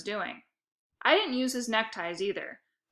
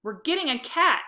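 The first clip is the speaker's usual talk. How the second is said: higher, loud squawk; 6 semitones higher, 6 dB louder than usual. A narrator's reading. Lexi squawking back at her brother. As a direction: squawk it right back at him. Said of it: "she squawked back".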